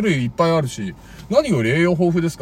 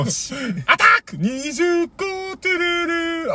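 high, drawn-out game show call; quiz host impression; 11 semitones higher, 3 dB quieter, slower than usual. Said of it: "児玉清。".

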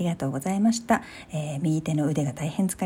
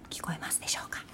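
breathy ASMR whisper; 14 dB quieter, faster than usual. Real, not acted. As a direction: softly whisper into the mic. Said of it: "このエーエスエムアール的な".

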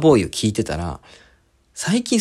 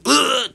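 mock dying groan, high and strained; exaggerated groan; 13 semitones higher than usual. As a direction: ham up a shot man's groan. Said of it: "なんか大げさに". (大げさなうめき声)

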